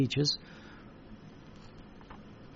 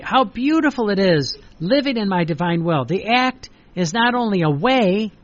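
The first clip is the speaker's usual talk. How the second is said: louder, swelling devotional reading; fervent reading; 4 dB louder than usual. Acted. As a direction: proclaim the divine words with fervour. Reading the revelation aloud.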